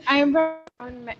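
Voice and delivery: normal talking voice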